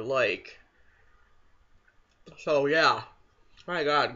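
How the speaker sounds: talking while swallowing; cold food in mouth; mouth full of ice cream. Real, not acted. Seen 1: Bite taken at -1.0; lips pressed, savoring while speaking.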